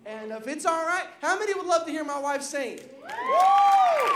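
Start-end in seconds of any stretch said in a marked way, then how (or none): 3.0-4.1 very high whooping cheer; excited woo. Real, not acted. Husband cheering before his wife sings, high whoop.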